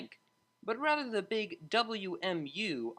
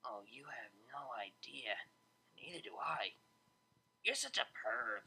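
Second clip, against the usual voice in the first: teasing whisper; 4 semitones lower, 7 dB quieter than usual. A narrator's reading.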